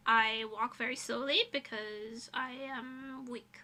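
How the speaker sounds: ordinary delivery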